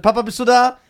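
put-on scared child calling out to dad. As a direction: call through the door, worried. Child calling to father outside.

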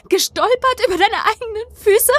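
very high, disbelieving question; incredulous. A narrator's reading. Narrator doubting friend's excuse of stumbling, incredulous. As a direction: throw the excuse back, high and scornful. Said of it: "Ich lachte ungläubig auf".

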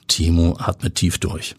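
plain reading voice

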